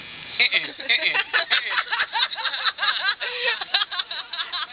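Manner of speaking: long high burst of laughter. Real, not acted. Friend laughing hard at the dolphin joke, long high laugh.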